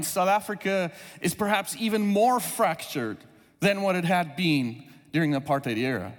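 ordinary talk

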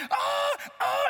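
two high imitated shrieks; hammed-up high cries; mock baby scream. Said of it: "she's like".

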